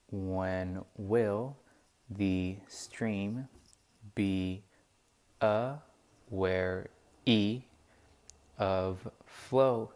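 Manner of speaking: slow, word by word, stressing each beat; scanning the meter. Shown as a demonstration. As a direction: read it out, marking every stress. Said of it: "I have the stresses on these words".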